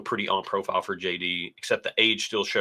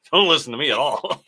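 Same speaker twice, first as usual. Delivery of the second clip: said through real laughter, a little higher and louder; laughing; 6 semitones higher, 4 dB louder than usual. Real, not acted. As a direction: laugh it off at your own mistake. Joking self-correction, laughing.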